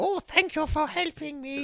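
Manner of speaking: put-on high, frail old lady. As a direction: squeak it like a little old lady. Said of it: "I hope he did an old lady voice".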